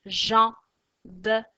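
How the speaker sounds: "Jambe" loud, split as jam, be; syllable by syllable. Shown as a demonstration. Teacher names the fourth drawing, syllable by syllable. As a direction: pause before the final be of "Jambe".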